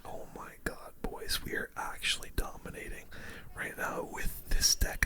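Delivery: normal talking voice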